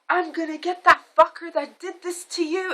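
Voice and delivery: high, intense furious vow; imitating a woman freaking out; mock enraged threat. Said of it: "freaking out".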